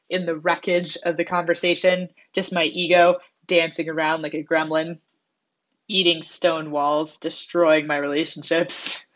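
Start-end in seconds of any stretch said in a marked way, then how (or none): none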